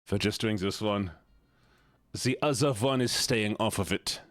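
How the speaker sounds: resigned mock German grumbling; put-on German accent. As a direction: sigh along in a hammed-up German accent. Keeps up German voice.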